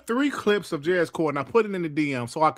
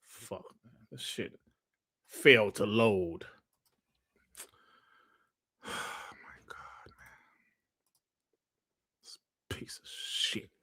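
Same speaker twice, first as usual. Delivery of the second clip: muttered and whispered curses, much softer; muttered cursing; 6 semitones lower, 9 dB quieter than usual. Real, not acted. Streamer fuming quietly at broken Twitter, muttered. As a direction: grumble it half-voiced under the breath. Cursing under breath, clips failing.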